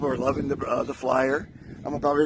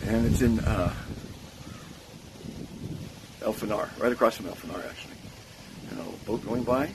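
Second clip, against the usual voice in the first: ordinary talk, nothing marked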